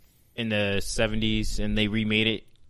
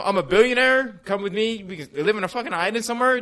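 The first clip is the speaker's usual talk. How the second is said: mock pickup line; 9 semitones higher, 4 dB louder than usual. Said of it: "You know".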